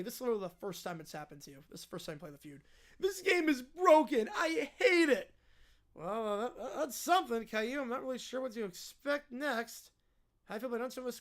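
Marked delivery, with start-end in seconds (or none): 3.0-5.3 slow, tearful bratty protest; sulking child in a mock tantrum; whiny kid. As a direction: drag the complaint into a whine. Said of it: "peak whiny kid territory".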